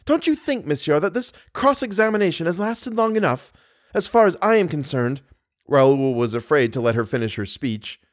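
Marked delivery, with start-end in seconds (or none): 0.0-5.2 high-pitched, indignant protest; exasperated. A narrator's reading. Girl tires of cross-examination, exasperated. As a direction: protest high, fed up with questioning. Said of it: "driven to exasperation".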